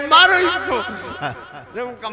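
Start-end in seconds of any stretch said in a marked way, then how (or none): 0.1-0.8 much higher and louder, a laughing yell; mock crowd shout. Preacher imitating the crowd calling to beat him, mock shout. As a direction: shout it like an angry mob, half laughing.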